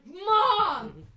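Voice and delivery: high, loud exasperated cry; exasperated shout. Retold or imitated. Daughter to mom, exasperated high shout. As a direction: yell it in disbelief.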